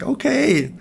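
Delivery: high, incredulous inner voice; exasperated disbelief. Retold or imitated. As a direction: say it like you can't believe it. Retold thought at far-right protest.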